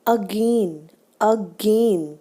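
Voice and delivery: Again as a-GAIN, weight on गेन; second-syllable stress. Shown as a demonstration. Teacher showing second-syllable stress, a-GAIN for again. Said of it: "again पे stress आ रहा है".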